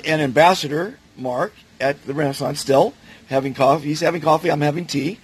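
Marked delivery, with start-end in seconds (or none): none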